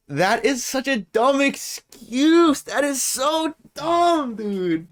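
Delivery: high-pitched, drawn-out incredulous complaint; slow, high wail of disbelief; exasperated disbelief. Real, not acted. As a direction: stretch it out slowly, way up high, utterly exasperated. Exasperated reaction to an excuse.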